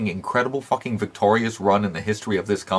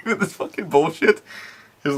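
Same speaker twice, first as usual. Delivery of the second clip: laughing; 8 semitones higher than usual. Real, not acted. Laughing at the old angle.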